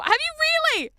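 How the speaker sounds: high, loud squeal of disbelief; amazed, high-pitched outburst; astonished. Real, not acted. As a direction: blurt it out, voice leaping way up. Reacts to a surprising claim.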